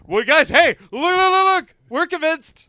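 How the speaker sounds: loud, high, urgent sales pitch; excited pitch. Pretend. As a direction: plead hard like a desperate team owner. Acting as team owner pitching.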